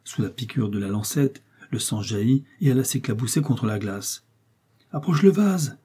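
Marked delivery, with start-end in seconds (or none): 4.9-5.8 exclaiming. A narrator's reading. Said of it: "exclama Charles".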